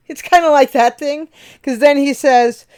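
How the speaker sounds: suddenly lit up by the idea; excited rush. Real, not acted. Amused burst mid-explanation.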